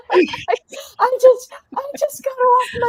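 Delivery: high laughing-crying squeal. Real, not acted. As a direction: laugh it out helplessly. Laughing at own confession.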